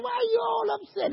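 very high-pitched, puzzled question; high mock bewilderment; Jesus questioning disciples. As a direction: chide them in a lifted, acted voice. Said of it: "And I said".

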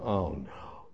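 low, soft sinking groan; quiet dismay. Retold or imitated. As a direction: let the words sink, defeated. Dismay at very long line.

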